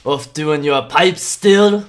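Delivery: loud, slow, scornful question; disapproving father. Acted. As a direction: drag it out with disapproval. Gruff father needles a villager.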